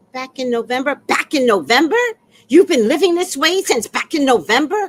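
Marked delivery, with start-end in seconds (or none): 0.9-2.0 loud, incredulous echo; appalled disbelief. Retold or imitated. HOA woman hears how long it has gone on, loudly appalled. 2.5-4.9 loud, high-pitched scolding question; mock scandalized official, voice raised; appalled disbelief. HOA woman confronts tenant living with the leak, loudly incredulous.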